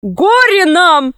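high, drawn-out wail of doom; alarmed, stretched-out shout; sailor's cry. A narrator's reading. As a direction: cry woe to the whole crew. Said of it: "крикнул матрос".

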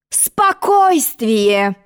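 slow, pointed announcement; slow drawn-out. Acted. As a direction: stretch the word out very slowly. Queen's slow answer.

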